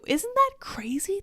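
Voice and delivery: high, slow, breathy whisper. Real, not acted. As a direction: whisper it in disbelief. Rant aside, whispered.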